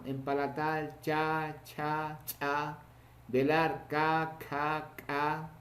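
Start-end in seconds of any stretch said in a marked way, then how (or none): 1.0-2.8 slow, separate palatal CHA syllables; consonant pronunciation drill. Shown as a demonstration. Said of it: "pronunciando estos consonantes". 3.9-5.5 slow, separate velar KA syllables; consonant pronunciation drill. Shown as a demonstration. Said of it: "pronunciando estos consonantes".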